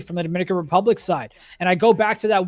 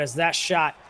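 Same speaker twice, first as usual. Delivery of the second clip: usual speaking voice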